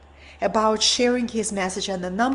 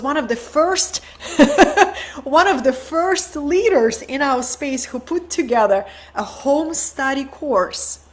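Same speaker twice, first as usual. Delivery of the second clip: a burst of laughter, then higher, louder words through it; laughing delight; 3 semitones higher, 4 dB louder than usual. Real, not acted. Praising Yogananda's home study course, laughing and excited. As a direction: laugh with joy and carry it into the line.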